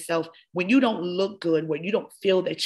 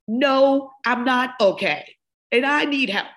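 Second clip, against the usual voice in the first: emotional; 6 semitones higher, 4 dB louder than usual. Real, not acted. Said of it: "I'm getting a little emotional".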